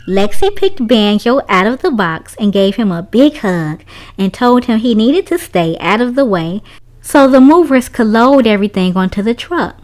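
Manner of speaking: usual speaking voice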